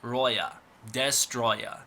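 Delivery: usual speaking voice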